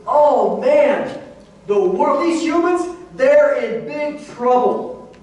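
heavenly onlookers dismayed at humanity; alarmed heavenly attitude. Retold or imitated. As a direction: sound worried for the humans, drawn out at the end. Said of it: "the attitude is".

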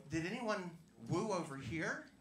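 normal talking voice

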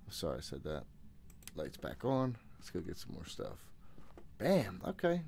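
ordinary talk, nothing marked

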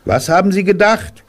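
put-on high, bright child asking; high boy's question. A narrator's reading. Boy asking Pooh about the bees, high and curious.

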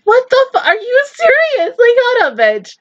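high-pitched astonished disbelief; incredulous. Real, not acted. Viewer stunned by the actress's other roles, high incredulous cry.

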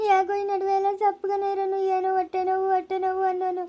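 tearful lament, words drawn into a wail; bereaved mother's helpless weeping; crying. Acted. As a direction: pour out your grief through tears. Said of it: "ಅಳ್ಬೇಡ".

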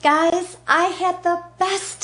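high, loud excited call-out. Acted. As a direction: call out to them with joy. Excited sign-off to viewers.

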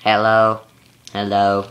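ordinary delivery

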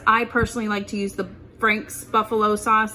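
usual speaking voice

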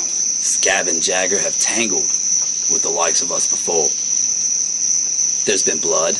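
slow, low, dramatic fight-night promo read; wrestling promo. Acted. Two hosts hyping a tag team match, low dramatic promo. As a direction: build up the match like a trailer voiceover.

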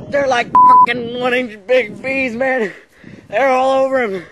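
very high, loud, frantic shouts; panicked screaming. Acted. Friend watching bees chase Paul, high frantic screaming. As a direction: scream warnings at someone running. Screaming warnings during bee attack.

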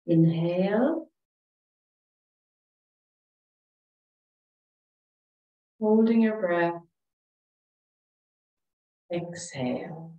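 calm, soothing relaxation voice with long pauses; guided meditation. Real, not acted. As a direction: guide them gently through each breath, leaving space. Leads breathing and meditation.

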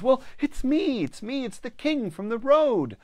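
high, loud, urgent plea to be recognised; desperate king pleading. Acted. King begging the family at the door, high and loud.

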